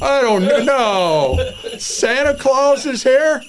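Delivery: high, slowed "Santa Claus is here?"; mock disbelief. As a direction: play up the shock of a surprise guest.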